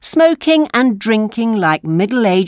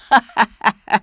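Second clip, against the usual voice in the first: put-on sly old man's chuckle; 3 semitones higher than usual. A narrator's reading.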